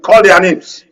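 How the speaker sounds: a senator's loud dare; retold challenge.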